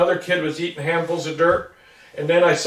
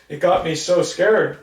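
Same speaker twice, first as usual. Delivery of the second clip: normal talking voice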